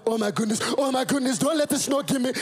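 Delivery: high, hammed-up panic in Nigerian accent; scared Nigerian father. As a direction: imitate dad fleeing the snow in mock terror. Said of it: "This is my father walking".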